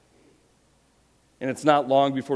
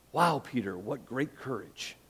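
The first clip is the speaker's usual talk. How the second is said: sarcastic praise; 6 dB quieter than usual. Real, not acted. Ironic praise of Peter.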